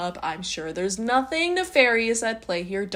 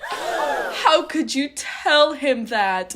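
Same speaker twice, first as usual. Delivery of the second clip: slow, higher, aghast; shocked dad; 6 semitones higher, much slower than usual. Retold or imitated. Dad aghast that mom told Kyle, slow mock horror. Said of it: "the dad is like".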